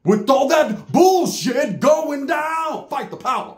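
slow, drawn-out high soul croon with a shout. Retold or imitated. Imitating the cousin belting like an Isley brother. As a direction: belt it like a seventies soul singer. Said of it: "sounded like one of the Isley brothers".

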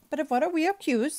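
high, loud, exasperated question; impatient. A narrator's reading. Servant questioning the judge about the charge, impatient. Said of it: "said Passepartout impatiently".

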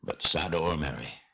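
low, fading farewell; dying dwarf king, solemn. Acted. Dying Thorin parting in friendship from Bilbo, solemn and fading. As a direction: let the voice sink and soften towards the end.

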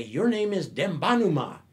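loud, high ceremonial announcement; proclaiming the name. Retold or imitated. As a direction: proclaim it to the whole village. Elders proclaim the new name.

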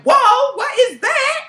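high, loud shocked outburst. Retold or imitated. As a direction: gasp it out, stunned by the smell. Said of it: "I was like".

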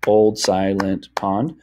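put-on OLD SILENT POND in even, separate beats; OLD, SI, LENT, POND evenly spaced; syllables to a beat. Shown as a demonstration. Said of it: "clap to the beat".

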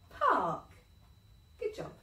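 ordinary delivery

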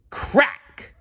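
unvoiced snapping crack effect; breathy crack sound effect; sharp crack sound. A narrator's reading. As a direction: spit out a crisp crack like splitting wood. Narrator voices branch snapping.

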